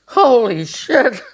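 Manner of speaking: very slow, high and loud; shocked. Retold or imitated. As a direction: drag it out in disbelief. Retelling his shocked reaction.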